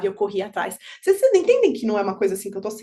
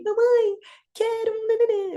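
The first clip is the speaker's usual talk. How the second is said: put-on high-pitched little girl asking mummy; imitated small child; 12 semitones higher than usual. Voicing the child's request.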